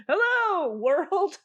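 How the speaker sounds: grand greeting. Retold or imitated. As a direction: greet the world like a parade queen. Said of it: "just being like".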